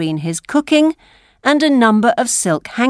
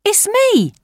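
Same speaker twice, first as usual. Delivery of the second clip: put-on high-pitched boy's call back; street boy; 14 semitones higher than usual. A narrator's reading. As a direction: answer in a high young lad's voice. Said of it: "said the Dodger".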